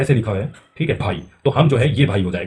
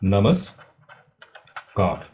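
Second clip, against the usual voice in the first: नमस्कार stretched very slowly and lower; drawn-out reveal; 4 semitones lower, much slower than usual. Shown as a demonstration. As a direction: draw out नमस्कार slowly, falling at the end. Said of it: "तो ये कैसे बनेगा?".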